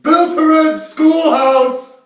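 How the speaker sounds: excited shout. Acted.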